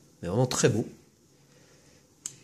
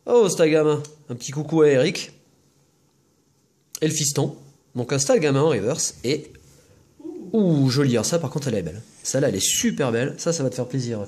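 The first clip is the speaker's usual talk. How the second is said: ordinary talk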